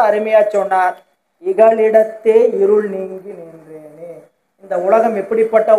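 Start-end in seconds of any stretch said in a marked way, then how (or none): 1.4-4.1 slow chant-like verse, sinking towards the end; hymn recitation. Shown as a demonstration. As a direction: recite the closing line with drawn-out syllables. Quotes a hymn line.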